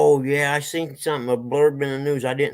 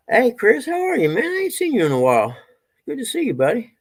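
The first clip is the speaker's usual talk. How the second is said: much higher and louder, bubbling hello; excited greeting; 10 semitones higher, 4 dB louder than usual. Real, not acted. Greeting Chris after a long while, excited.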